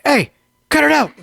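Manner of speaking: high, loud scolding shout; retold yell. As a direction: yell at a misbehaving pet. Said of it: "like, yell, like".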